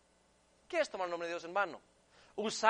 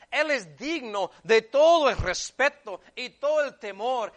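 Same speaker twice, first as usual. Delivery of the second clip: loud, higher, fervent sermon delivery; impassioned preacher driving every phrase home; full-force preaching; 3 semitones higher, 6 dB louder than usual. Real, not acted. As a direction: preach it hard, building to loud cries of disgust.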